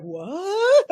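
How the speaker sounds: high-pitched, baffled "what"; stunned reaction. Retold or imitated. Imitating him over there after being thrown, high and baffled. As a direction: mimic someone caught completely off guard.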